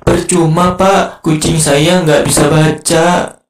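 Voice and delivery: loud, high, slow mock wail; hammed-up tearful outburst; crying. A narrator's reading. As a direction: bawl it out as a childish lament. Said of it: "Mamat yang sedang nangis".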